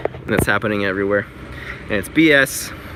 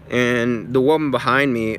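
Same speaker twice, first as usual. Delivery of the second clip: normal talking voice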